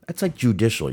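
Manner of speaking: normal talking voice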